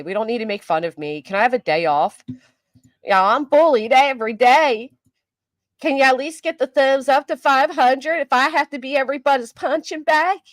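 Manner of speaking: ordinary talk, nothing marked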